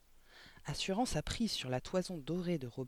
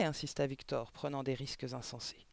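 usual speaking voice

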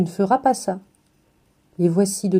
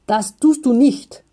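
higher, louder, slow German, each syllable hammered; every syllable pressed hard; 4 semitones higher, 6 dB louder, slower than usual. A narrator's reading. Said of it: "en appuyant très fort sur chaque syllabe". (chaque syllabe de das tust du nicht martelée, plus fort et lent)

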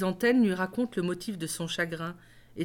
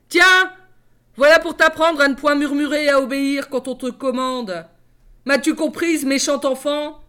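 high, loud raging scold; furious stepmother; 6 semitones higher, 10 dB louder than usual. Acted. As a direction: shout her down after the slap. Stepmother slaps and scolds girl.

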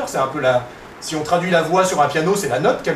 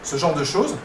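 ordinary talk, nothing marked

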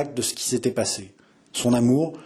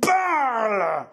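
higher, sharp furious command; fierce order; 9 semitones higher than usual. A narrator's reading. François commanding his brother to confess, enraged. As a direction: order him to speak with bloodlust. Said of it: "jeta un regard sanglant et dit".

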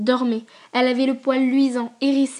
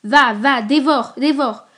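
loud, commanding cry; fierce cry; 7 dB louder, slower than usual. Acted. Old mother shouting at her dog to attack, fierce and loud. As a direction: set the dog on him with a shout. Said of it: "elle cria".

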